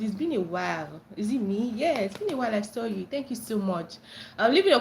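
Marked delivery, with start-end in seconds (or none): none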